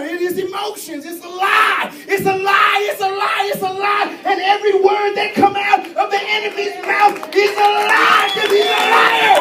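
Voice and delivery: loud, high preaching shout; preaching at full force. Acted. Preacher crying out that the enemy lies, full shout. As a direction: hammer the lie home to the church.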